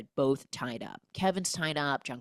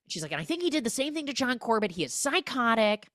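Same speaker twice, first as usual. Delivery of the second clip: higher and fast, tumbling through the evidence; frantic rushed theory; 7 semitones higher, faster than usual. Acted. J-Lo rushing her theory over the phone, high and frantic. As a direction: rattle off the evidence in a hurry.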